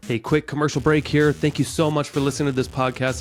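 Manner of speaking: punchy, projected host promo; energetic commercial-break read; podcast ad read. Acted. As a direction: sell your service straight to listeners. Host reads own commercial.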